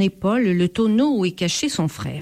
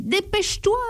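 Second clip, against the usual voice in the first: mild whine; 12 semitones higher than usual. A narrator's reading. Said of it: "gémit le voleur".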